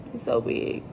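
"He's so big" breathed out, soft, near tears; about to cry. Real, not acted. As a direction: let "He's so big" catch in the throat. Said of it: "I'm finna start crying again".

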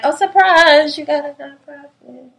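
high, bright "surprise" then dropping off; spring it on her playfully; mock surprise reveal. Friend revealing paperwork for the nonprofit, mock surprise. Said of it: "was like".

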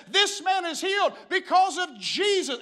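high, loud proclamation; shouted preaching. Acted. Peter stands up after healing a lame man, shouted preaching. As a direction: proclaim it at full force like a street preacher. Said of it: "And he preaches".

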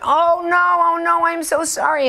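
high, loud, panicked apology; mortified apology. Retold or imitated. Acted mortified apology to nuns.

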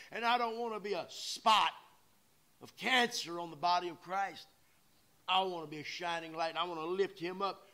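loud, high, driving pulpit cry; preaching at full force. Acted. Preacher urging the congregation to remind themselves, loud preaching. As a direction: drive it home to the congregation.

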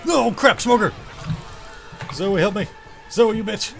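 frantic high yell at a teammate; panicked yelling. Real, not acted. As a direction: shout for help in a panic. Grabbed by a smoker, yelling for help.

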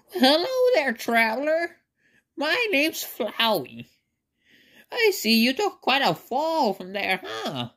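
high-pitched cartoon flower voice; high, put-on voice greeting a fallen traveler; Flowey. Flowey greets the newly fallen traveler, high put-on voice. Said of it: "I'm gonna do my impersonation of Flowey".

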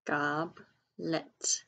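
"Goblet" split as Gob, pause, let; slow, broken-up "Goblet" for early readers; syllable by syllable. Shown as a demonstration. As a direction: sound out "Goblet" in two separate syllables. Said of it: "sound it out by syllables".

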